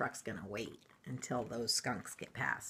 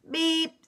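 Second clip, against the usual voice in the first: one high, louder beep; truck horn beep; 14 semitones higher, 9 dB louder than usual. Acted. Blue's horn in story.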